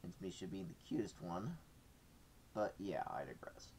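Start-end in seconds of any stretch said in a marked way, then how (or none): 3.1-3.7 whispered aside. Real, not acted.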